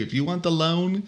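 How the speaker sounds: a touch of a bossy lender ordering clients; mock bossy order. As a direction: order clients around, half joking. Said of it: "I've wanted to say this to some of my clients".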